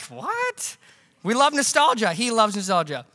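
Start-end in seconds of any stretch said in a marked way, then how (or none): 0.0-0.8 a very high, incredulous what; baffled parent. Retold or imitated. Parents hearing the Monopoly worry, incredulous. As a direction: squeak out total disbelief. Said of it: "We're like".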